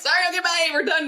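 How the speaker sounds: high, quick dismissive goodbye; mock breezy brush-off; curt brush-off. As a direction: slam the door on the talk, lightly. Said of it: "shutting out".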